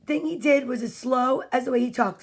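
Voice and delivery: ordinary delivery, nothing marked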